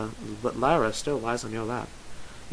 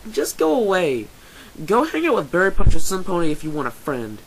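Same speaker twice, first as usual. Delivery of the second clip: high, loud, hurt outburst; loud, upset rejection; angry dismissal; 7 semitones higher, 8 dB louder than usual. Acted. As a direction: shout them away from behind a door. Hurt Lyra sends the listener away.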